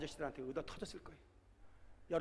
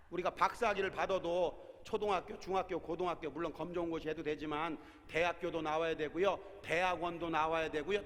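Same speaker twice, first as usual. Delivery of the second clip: normal talking voice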